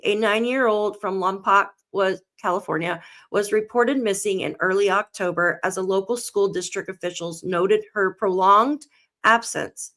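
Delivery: ordinary delivery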